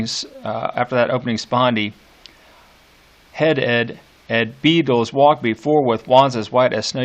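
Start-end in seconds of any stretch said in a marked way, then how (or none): 3.3-7.0 "head ed, ed beetles" stepped out in even da-DUM beats; iambic beat. Shown as a demonstration. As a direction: tap out each iamb evenly. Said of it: "You can hear that that's an iambic beat".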